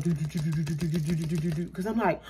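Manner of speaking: rapid soft "do-do-do" heartbeat; racing heartbeat imitation. Girl about to go to jail, imitated racing heartbeat. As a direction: imitate a pounding heart in quick low syllables. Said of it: "My heart beat like".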